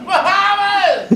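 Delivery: very high, drawn-out whoop; hollering on vacation; party whoop. Retold or imitated. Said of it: "you're like".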